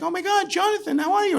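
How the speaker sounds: put-on high, gushing girlish voice; Liz's delighted greeting. Liz answering Jonathan's call, high and gushing. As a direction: gush over an old friend calling.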